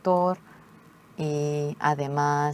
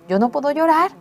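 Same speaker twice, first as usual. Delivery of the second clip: higher, louder pleading wail; anguished tearful cry; 10 semitones higher, 7 dB louder, faster than usual. Retold or imitated. Daughter protests to mother she cannot cry, anguished. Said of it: "Hejin llorando dijo".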